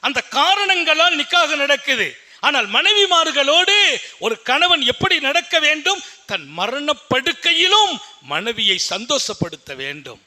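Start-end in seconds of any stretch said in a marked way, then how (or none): 0.1-6.0 higher, raised sermon voice; fervent preaching. Acted. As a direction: proclaim it to the congregation. Preaching at full force. (உரத்த பிரசங்க குரல்)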